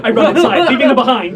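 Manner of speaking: high, loud, laughing rush; gleeful high-pitched announcement; excited dash. Acted. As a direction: blurt it out loudly through laughter. Player acts rushing in, laughing.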